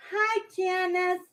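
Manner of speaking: ordinary talk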